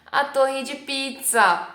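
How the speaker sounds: high, slow mock tourist saying "a torre de pizza". Mocking a tourist in Pisa, high and slow. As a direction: play the clueless tourist who mixes up Pisa and pizza. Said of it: "chegar em Pisa e falar".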